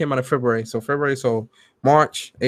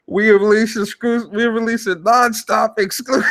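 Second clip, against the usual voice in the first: mock hype quote; 7 semitones higher than usual. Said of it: "then my man said".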